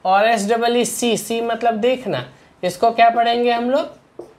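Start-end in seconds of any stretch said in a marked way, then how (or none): none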